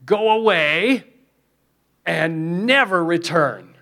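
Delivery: loud, high, slow command; Smeagol's command. Retold or imitated. Smeagol driving the demon away, loud, high and slow. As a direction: drag it out, banishing the demon. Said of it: "he says this".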